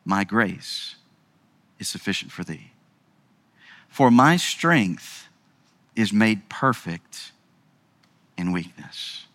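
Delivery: low, soft and slow; calm voice of God, fading soft; gentle divine answer. Acted. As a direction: reassure like a father. God's answer quoted, scripture.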